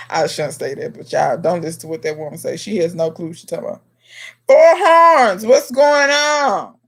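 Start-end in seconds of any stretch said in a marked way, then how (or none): 4.5-6.8 high and loud on Four Horns, then a slow what's going on; excited greeting. Real, not acted. Greeting viewer Four Horns as they join the live, excited. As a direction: call out to a friend who just arrived.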